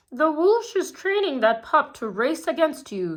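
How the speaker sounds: scheming villain reporting news; Mayor Humdinger's voice. A narrator's reading. Mayor Humdinger warning the cheetah, scheming. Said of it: "said Mayor Humdinger".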